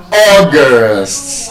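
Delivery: August drawn out with the beat up front; stress on first syllable. Shown as a demonstration. Teacher correcting the class on the month, first-syllable stress. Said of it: "that month is pronounced".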